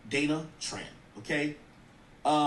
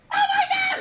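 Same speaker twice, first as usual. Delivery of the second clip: loud, high Oh my God; shocked outburst; 7 dB louder than usual. Real, not acted. Host shocked at the clip, shrieking oh my God. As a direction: shriek it in disbelief.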